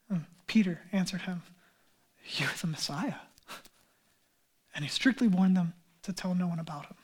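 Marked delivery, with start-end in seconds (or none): 2.4-3.2 low, near-whispered awe; hushed confession. Acted. Peter answers Jesus who he is, hushed. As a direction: say it very softly, almost whispering.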